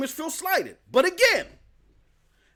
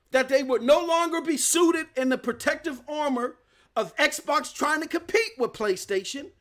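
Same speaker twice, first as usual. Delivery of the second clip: usual speaking voice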